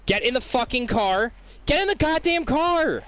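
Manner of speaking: high, exasperated shouting at the game; furious yell at a stubborn NPC; frustrated yell. Real, not acted. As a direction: yell it, losing patience. Yelling at NPC to get in car.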